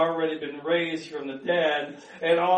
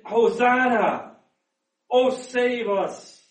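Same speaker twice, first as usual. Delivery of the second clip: slow, higher, louder call for rescue; drawn-out acclamation; crowd's plea; 5 semitones higher, 4 dB louder, slower than usual. Acted. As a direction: cry out to God, stretching each word. Voicing the crowd's cry to God.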